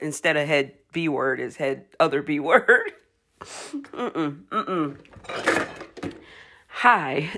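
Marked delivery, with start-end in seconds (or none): none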